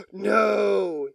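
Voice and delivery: a real shocked cry of no; reading a shocking news item, real shock. Real, not acted. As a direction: blurt it out in disbelief. Shocked by what he reads.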